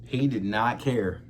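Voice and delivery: usual speaking voice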